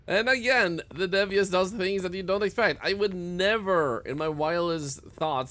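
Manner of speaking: high, loud amazed telling; excited. Real, not acted. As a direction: rave about it, amazed. Excited after the surprise.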